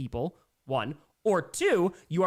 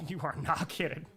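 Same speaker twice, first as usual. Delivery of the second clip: laughing agreement. Real, not acted. Laughing agreement with viewer's complaint.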